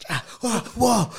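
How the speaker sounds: high-pitched mock-alarmed yelps; hammed-up startled cries; retold panic. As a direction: act out waking up in sudden alarm. Acts out waking to accident.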